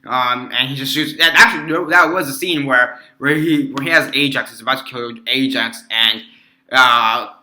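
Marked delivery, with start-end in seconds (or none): none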